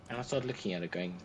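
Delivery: normal talking voice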